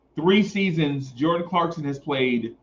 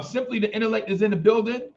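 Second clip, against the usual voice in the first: higher put-on voice; 7 semitones higher, faster than usual. Repeats catchphrase in put-on voice.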